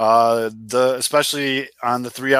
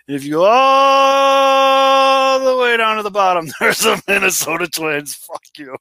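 gloating build-up; 9 semitones higher, 5 dB louder, slower than usual. Real, not acted. Gloating over rival's standing.

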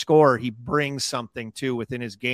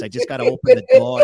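squeaky mock coach; 11 dB louder, much faster than usual. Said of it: "he goes".

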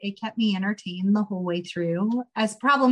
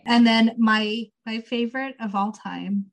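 ordinary talk

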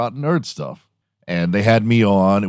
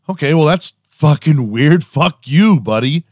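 louder, higher-pitched offended outburst; mock indignation; 7 semitones higher, 5 dB louder than usual. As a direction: act out an indignant reaction to a snub. Acted indignant reply to being snubbed.

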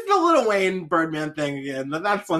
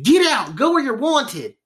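high, hammed-up country-accent yell; 7 semitones higher, slower than usual. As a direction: order them out in a mock country accent. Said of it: "didn't say it with a country accent".

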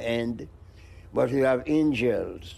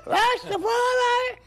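slow falsetto crowing of Rastafari; rooster crow; 3 dB louder than usual. Pretend. Crowing like a rooster for the interviewer, slow falsetto. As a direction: crow it out high and stretched like a rooster. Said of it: "says my back".

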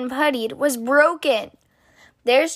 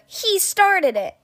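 put-on child's cry; 8 semitones higher, slower than usual. A narrator's reading. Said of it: "Cries of".

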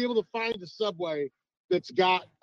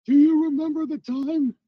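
put-on old man voice; 7 semitones higher, 4 dB louder than usual. Mocking old man reminiscing.

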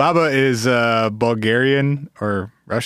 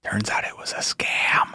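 slow, soft whispered aside; 2 semitones lower, 6 dB quieter, slower than usual. Real, not acted. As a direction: breathe it out as a secret. Whispered aside about scam.

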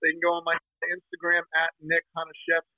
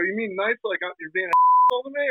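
higher, louder, acted suspicion; mock indignant challenge; 5 semitones higher, 5 dB louder than usual. Jersey man questions a stranger's compliment, mock indignant. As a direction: challenge him, voice rising in pitch.

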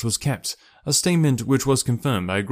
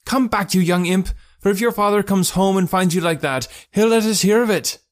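high-pitched scolding mother, getting louder; acted shout, rising to a peak; 9 semitones higher, 5 dB louder than usual. A narrator's reading. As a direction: holler a threat after a runaway child. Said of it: "she shouted".

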